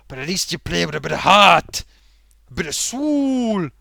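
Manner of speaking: loud, fervent praise of heart and soul; loud passionate plea. Acted. Puppet fan pleading loudly for heart and soul. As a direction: cry it out with passion.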